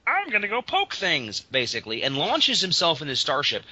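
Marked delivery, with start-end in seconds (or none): 0.1-1.4 high, lightly silly imp voice; mock eager imp. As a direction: imitate a daft imp, pitched up. Voicing bumbling imp's plan.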